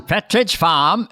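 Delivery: slow, drawn-out New England accent. Shown as a demonstration. Showing the key phrase, slow New England accent. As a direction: stretch it out in New England dialect. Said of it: "You can get it in one word".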